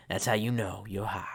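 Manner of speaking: low conspiratorial whisper. Acted. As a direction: whisper the secret sideways. Whispered stoner aside.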